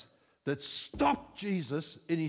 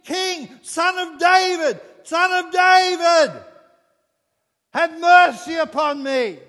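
much higher, louder, slow pleading cry; desperate shout; 11 semitones higher, 13 dB louder, slower than usual. Acted. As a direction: shout over the crowd begging for mercy. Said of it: "here's this voice that's saying".

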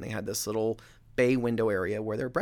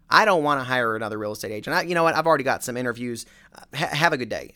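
irritated brush-off; 2 semitones higher, 9 dB louder, much faster than usual. Retold or imitated. Annoyed homeowner brushes off agent.

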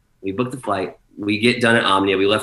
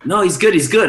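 imitated staff, high and louder; hasty reassurance; 10 semitones higher, 5 dB louder than usual. Club staff telling panicked DJ he's good, hastily reassuring. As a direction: mimic someone brushing off a worry in a raised voice.

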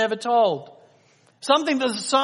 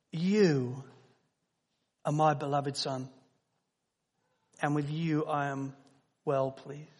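tender father's voice; 6 semitones lower, 8 dB quieter than usual. Acted. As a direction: say it softly like a proud father to his son. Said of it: "the unmistakable voice of the Father".

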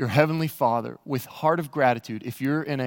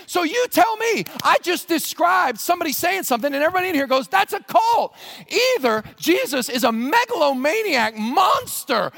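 much higher and louder, shouting; preaching at full force; 13 semitones higher, 6 dB louder than usual. Acted. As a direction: thunder it out to the whole room. Preacher builds to a shouted climax.

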